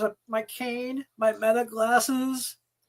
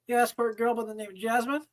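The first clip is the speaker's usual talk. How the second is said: ordinary talk, nothing marked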